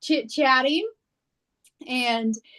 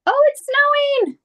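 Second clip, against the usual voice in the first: thrilled high shout at the snow; excited; 6 dB louder than usual. Real, not acted. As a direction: burst out, delighted. First sight of snow outside.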